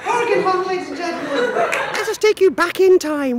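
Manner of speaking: ordinary delivery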